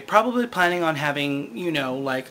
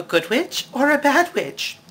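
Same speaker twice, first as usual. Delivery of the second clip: lightly voiced Glinda; 8 semitones higher than usual. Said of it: "she asks Dorothy".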